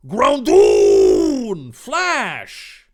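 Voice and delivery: very loud drawn-out call, then a sharp order; battle command shout. Acted. Trainer orders the creature to break the net, shouting. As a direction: bellow the name, stretched long. Calling creature to use attack.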